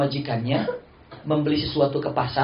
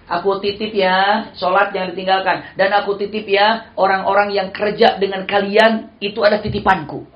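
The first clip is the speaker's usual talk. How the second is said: raised, loud, slow plea; voicing the Prophet; 6 semitones higher, 7 dB louder than usual. Preacher voicing the Prophet's tender plea, raised and loud. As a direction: plead it as the Prophet entrusting his people. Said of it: "kata Rasulullah".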